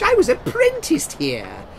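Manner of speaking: high, slow and moved; a man amazed to see his past; wondering. Acted. Scrooge recalls.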